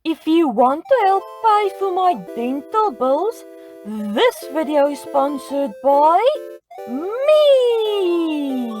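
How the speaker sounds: ordinary delivery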